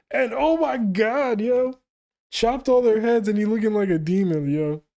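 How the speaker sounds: losing it over the slaughter panel; hyped. Real, not acted. Reader freaking out at a demon slaughter scene, hyped and loud.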